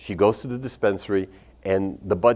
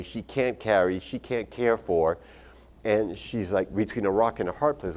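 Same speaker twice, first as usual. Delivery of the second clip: ordinary talk, nothing marked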